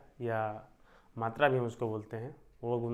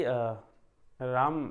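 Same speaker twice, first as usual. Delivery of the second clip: usual speaking voice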